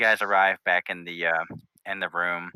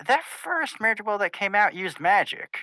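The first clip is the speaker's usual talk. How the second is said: much higher, slow realising voice; Rainbow Dash voice; 11 semitones higher than usual. Acted. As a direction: pitch up, slow dawning suspicion. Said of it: "Rainbow Dash pauses ... and goes".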